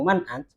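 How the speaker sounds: casual "Man ant" stopped on t; percakapan fasih. Shown as a demonstration. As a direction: say "ant" with no vowel after the t. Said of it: "kalau orang sudah sering ngomong". ("Man ant" gaya percakapan, akhirnya disukun)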